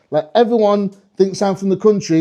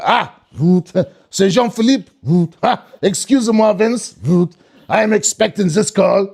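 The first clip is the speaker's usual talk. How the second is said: mock-French phone panic, buzzes between phrases; paranoid French accent; slower than usual. French person expecting a call, paranoid French accent. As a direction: imitate a jumpy French person hearing the phone. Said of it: "French people always sound paranoid".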